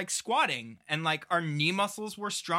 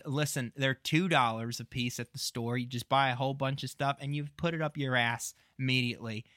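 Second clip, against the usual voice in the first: mock ad read; 4 semitones lower, 4 dB quieter than usual. Podcast host jokingly plugs Squash Spaghetti to listeners, mock ad read. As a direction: sell it like a cheesy radio sponsor.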